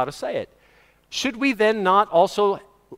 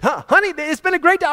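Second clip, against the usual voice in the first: high-pitched happy outburst; excited; 11 semitones higher, 4 dB louder than usual. Acted. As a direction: gush it to your wife. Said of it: "I mean, we're excited".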